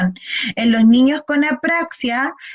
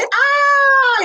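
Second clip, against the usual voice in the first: high loud yawning ah; 13 semitones higher, 3 dB louder than usual. Shown as a demonstration. Therapist showing a child a yawning ah. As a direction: let out a big yawn. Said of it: "hacemos un bostezo".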